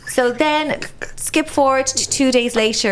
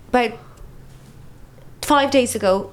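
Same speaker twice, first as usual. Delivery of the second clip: ordinary talk, nothing marked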